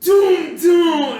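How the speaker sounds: loud, high, drawn-out "doom"; booming impact noise. Retold or imitated. Friend imitating the cinema seat slamming, loud booming sound effect. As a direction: boom it out like a violent jolt.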